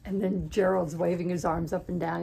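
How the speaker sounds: usual speaking voice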